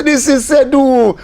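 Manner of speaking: slow, high, deliberate correction; slow insistence. Retold or imitated. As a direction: spell it out slowly to convince her. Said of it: "I say".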